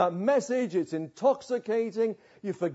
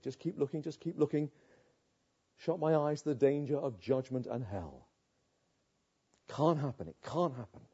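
low and much softer, a frightened inner murmur; hushed denial; 6 semitones lower, 8 dB quieter than usual. Acted. Sinner hushing himself, refusing to face God's anger, in a low frightened murmur. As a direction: mutter to yourself, scared to look.

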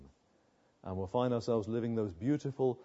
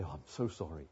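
Missus Vine apologizing; 10 semitones lower, 9 dB quieter than usual. Retold or imitated. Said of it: "she used to ring me up and say".

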